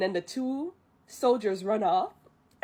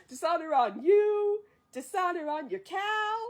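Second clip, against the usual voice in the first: high, theatrical mock outrage; Mushu's indignant curse; 6 semitones higher than usual. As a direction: pronounce it with hammed-up indignation. Mushu indignantly pronounces dishonor.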